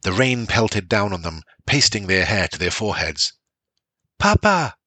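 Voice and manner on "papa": put-on high child's cry, raised; child calling. A narrator's reading. Children calling their father by the river, high cry. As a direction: call out to papa across the storm.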